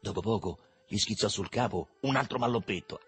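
usual speaking voice

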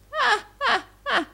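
very high staccato laugh; high, loud put-on cackle; old usurer's cackle. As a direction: cackle in separate bursts. Gride laughs at the retort.

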